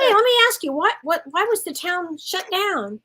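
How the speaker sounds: high, incredulous asking; surprised question. Retold or imitated. Retelling how she quizzed the post gal, high and incredulous. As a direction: pitch it up in disbelief.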